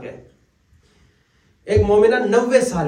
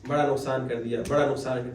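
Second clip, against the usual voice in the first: low, soft mock lament; lamenting relative; 7 semitones lower, 5 dB quieter than usual. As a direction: moan it like a regretful man. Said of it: "انہوں نے کہا". (دھیمی افسوس بھری آواز)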